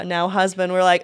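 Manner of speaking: ordinary talk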